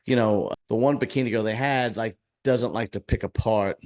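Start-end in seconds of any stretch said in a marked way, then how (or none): none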